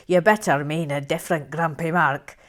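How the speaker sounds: put-on Scottish voice, loud; Scottish accent. Shown as a demonstration. Host rereading a viewer's comment, Scottish accent. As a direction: read it like an indignant Scot. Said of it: "read that in a Scottish accent".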